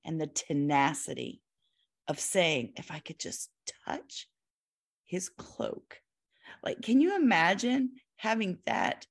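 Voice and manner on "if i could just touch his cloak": a soft whispered wish, much softer; whispered hope. Acted. Host voices the bleeding woman's hushed hope. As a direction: barely voice it, like a secret longing.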